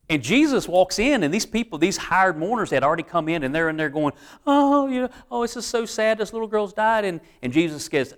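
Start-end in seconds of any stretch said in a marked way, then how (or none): 4.5-7.0 mock mournful wail, pitched high; fake crying. Hired mourners fake-wailing over the dead girl, mock mournful. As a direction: overdo the grief like a paid mourner. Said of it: "the fake crying".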